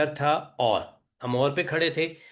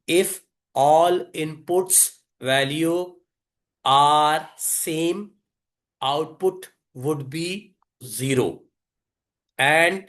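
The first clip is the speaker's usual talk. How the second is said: deliberate repeat with long gaps between words; slow dictation; much slower than usual. Real, not acted. Teacher repeating the definition for students to grasp, slow dictation. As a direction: dole out the rule word by word.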